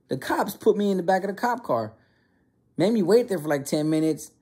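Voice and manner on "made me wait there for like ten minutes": ordinary delivery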